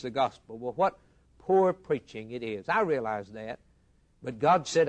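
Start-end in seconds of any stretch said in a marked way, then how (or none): none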